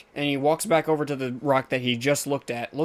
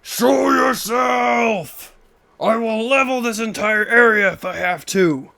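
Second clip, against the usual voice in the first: high, drawn-out booming shout; yelling out; 8 semitones higher, 6 dB louder, slower than usual. Acted. Game master's character threatening someone hiding, yelling out. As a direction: bellow a threat to someone hidden far off. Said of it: "yells out".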